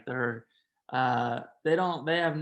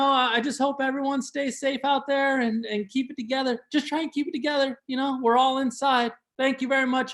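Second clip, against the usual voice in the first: high earnest plea; 8 semitones higher, faster than usual. Acted.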